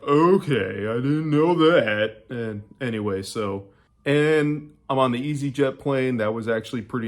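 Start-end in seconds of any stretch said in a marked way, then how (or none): 0.0-2.2 mock surprised realization, slow and high; a slow put-on voice for learning something new. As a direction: act out being caught off guard. Reacting to bus fare surprise.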